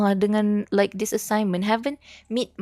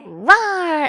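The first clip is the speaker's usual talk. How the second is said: higher, louder mock animal roar; playful roar; 7 semitones higher, 6 dB louder than usual. Streamer copying the roar alert, playful roar. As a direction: roar back like a toy beast.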